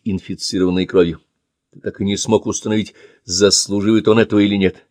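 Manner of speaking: usual speaking voice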